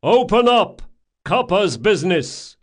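high, forceful bellowed demand; hard, loud order through a door; loud male shout. A narrator's reading. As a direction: shout to be let in at once. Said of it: "came a loud male voice".

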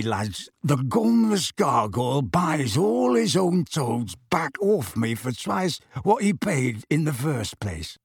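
slow, relishing crook's yarn; milk the funny story for the lads; roguish storyteller. Acted.

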